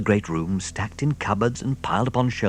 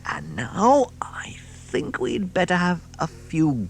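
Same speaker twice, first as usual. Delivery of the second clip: whisper; 9 semitones higher, slower than usual. Acted. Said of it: "unvoiced whisper".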